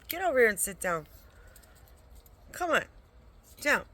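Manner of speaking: ordering the pet over to sit down, loudly; loud command. Real, not acted.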